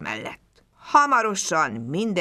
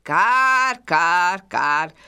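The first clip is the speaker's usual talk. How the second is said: cawing crow; 6 dB louder, much slower than usual. A narrator's reading. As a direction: caw like an old crow on a treetop. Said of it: "károgott a fa tetején a varjú".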